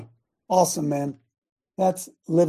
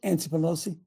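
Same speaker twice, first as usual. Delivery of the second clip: normal talking voice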